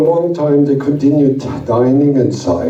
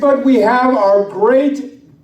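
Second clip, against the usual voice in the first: slow, drawn-out announcer call; announcer intro; 8 semitones higher, slower than usual. Acted. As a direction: stretch the name like a ringmaster. Announcing next performer.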